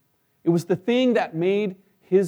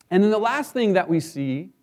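ordinary talk, nothing marked

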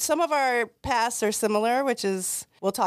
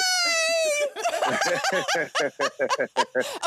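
high-pitched giddy shriek of laughter; excited squeal laugh. Real, not acted. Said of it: "I'm really loud and really excited".

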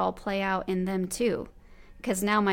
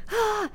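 put-on irritated kid groan; 13 semitones higher than usual. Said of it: "they'll just be like".

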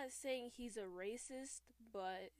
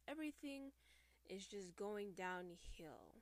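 usual speaking voice

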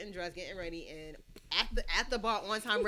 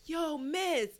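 higher, drawn-out pickup line; mock catcaller; 5 semitones higher, much slower than usual. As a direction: lightly imitate a man slowly calling after a woman. Imitating a catcaller drawing out pickup line.

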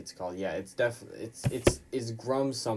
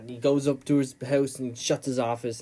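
usual speaking voice

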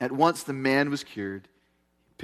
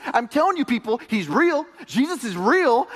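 fervent preaching; 7 semitones higher, 4 dB louder than usual. Real, not acted. Pastor preaching to the congregation.

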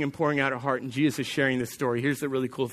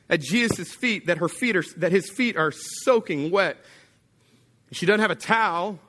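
ordinary talk

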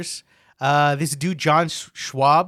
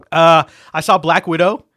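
ordinary talk

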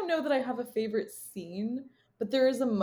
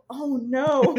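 imitated panic, raised and louder; panicked; 4 semitones higher, 5 dB louder than usual. Imitating the character's reaction to the fish, panicked. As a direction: mimic a sudden, heartfelt panic. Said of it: "it's such a panicked".

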